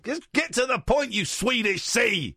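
loud high mock yell; high shout. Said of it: "how much I wanna say that".

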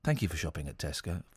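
deep monotone robot, very soft; flat machine drone, low and quiet. Shown as a demonstration. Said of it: "My robot voice".

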